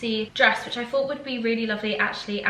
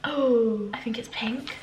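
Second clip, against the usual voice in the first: hushed, breathy little reveal; excited whisper. Real, not acted. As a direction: whisper it like a secret treat. Unwrapping a gift she loves.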